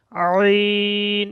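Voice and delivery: long "আইন" with the throat pressed tight; throat squeezed. Shown as a demonstration. Teacher showing squeezed throat sound, drawn out. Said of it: "গলা একটু চেপে".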